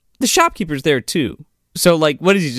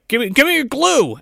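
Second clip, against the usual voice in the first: high-pitched put-on insistence; mock demand; 8 semitones higher than usual. Gunman demanding glue from scoutmaster, mock insistent. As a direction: demand it like a jumpy robber.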